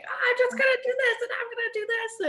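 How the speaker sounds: high, fast carefree voice; mock wild-child voice; wild carefree person. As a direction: play the reckless party girl. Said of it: "a super wild person who's like".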